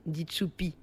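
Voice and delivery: plain reading voice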